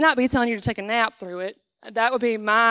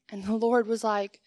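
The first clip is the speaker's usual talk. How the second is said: ordinary talk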